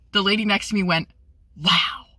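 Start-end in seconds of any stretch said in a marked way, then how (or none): 1.6-2.1 hushed, mock-disappointed reaction; imitated disappointed wow. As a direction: imitate a let-down moviegoer. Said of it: "she was so disappointed".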